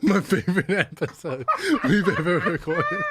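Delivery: words through giggles; laughing while talking. Real, not acted. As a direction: still laughing as you speak.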